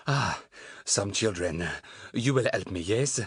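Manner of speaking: put-on halting French-accented English. A narrator's reading. Lost man asking children for help, French accent. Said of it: "suddenly going very French".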